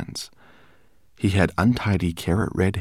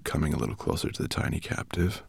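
usual speaking voice

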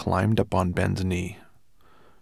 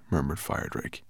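usual speaking voice

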